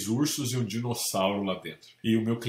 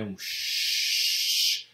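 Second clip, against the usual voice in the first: hissing shower noise; 3 semitones lower than usual. Retold or imitated. Imitating noise heard in house.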